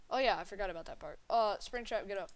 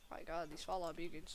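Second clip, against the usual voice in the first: ordinary delivery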